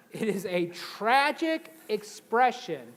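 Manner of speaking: "tragic" stretched out slow and loud; slow, loud, hammed-up delivery; slow dramatic emphasis. Shown as a demonstration. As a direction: lean on "tragic" theatrically. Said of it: "say it like this".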